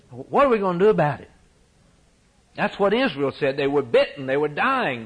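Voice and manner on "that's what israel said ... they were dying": ordinary delivery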